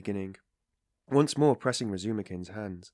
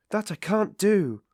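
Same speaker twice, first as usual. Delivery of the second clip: slow, high, loud indignant refusal; offended cry; 12 semitones higher, 8 dB louder, slower than usual. A narrator's reading. Sister refusing her brother's order, offended and loud. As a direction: draw each word out in hurt defiance. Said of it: "the girl cried, offended".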